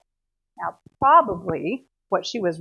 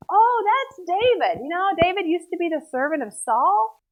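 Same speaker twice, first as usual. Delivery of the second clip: breezy reassurance; 9 semitones higher, 6 dB louder than usual. Retold or imitated. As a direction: brush off their worry, high and loud. Achish answers the other kings' query.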